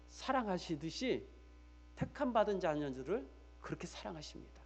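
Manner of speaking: normal talking voice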